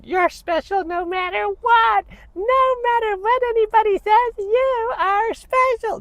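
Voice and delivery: very high, slow mocking falsetto; mocking sappy teacher. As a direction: drag it out sweetly high to mock her. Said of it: "I would not just be like".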